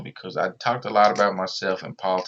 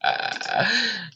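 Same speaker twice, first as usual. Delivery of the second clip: laugh; 13 semitones higher than usual. Real, not acted. Laughs about his show.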